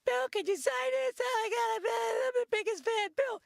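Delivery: put-on high-pitched, squealing little kid fan. Kid fan begging Bill to sign, high squeal. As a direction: gush like an overexcited kid begging for an autograph. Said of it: "He's like".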